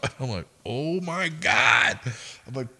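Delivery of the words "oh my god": high, loud, very drawn-out mock dismay; retold appalled groan. Shift leader overhearing the insult, retold appalled reaction. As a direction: stretch it out in hammed-up horror.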